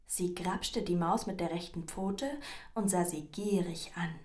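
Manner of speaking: usual speaking voice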